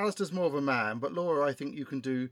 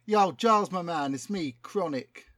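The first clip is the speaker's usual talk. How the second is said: lightly voiced London rapper, keen; a hint of a slangy London rapper; street rapper voice; 3 semitones higher, 4 dB louder than usual. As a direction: talk like a cocky MC pitching a hit. Plays rapper Chronic Cortex.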